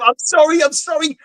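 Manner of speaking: put-on high, yelping child's plea; pleading kid. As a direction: beg like a kid getting walloped. Retold kid pleading being smacked.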